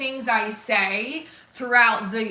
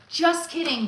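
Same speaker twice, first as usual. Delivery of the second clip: quick retraction; 6 semitones higher than usual. Acted.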